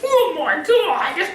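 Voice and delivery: very high, drawn-out cartoon squeal; Blue Pikmin. Repeating the character's catchphrase, in a high, slow cartoon voice. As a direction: stretch it out in a tiny creature voice. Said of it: "why I gave him that voice".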